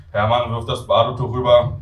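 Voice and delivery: ordinary delivery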